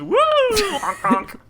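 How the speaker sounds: goofy clown honks; silly clown. Pretend. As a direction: honk like a clown horn. Clown enemy noise.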